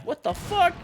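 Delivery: high-pitched shocked shriek. Real, not acted. Gamer caught off guard in the match, shrieking. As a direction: yelp it way up in shock. Sudden death in game.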